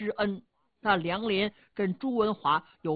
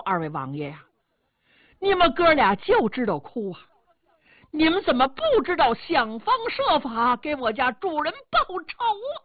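rising, louder, exasperated scolding; urgent angry rebuke; 6 semitones higher, 5 dB louder than usual. Acted. Steward scolds the weeping brothers to seek revenge, heated. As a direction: shake them out of their weeping with rising anger. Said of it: "朱安真急了".

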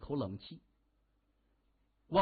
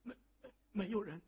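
high, soft, hurried stutter; nervous stammer; 7 semitones higher, 7 dB quieter, faster than usual. A narrator's reading. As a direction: stumble over the first word anxiously. Subordinate stammers answer on phone. (紧张得结结巴巴)

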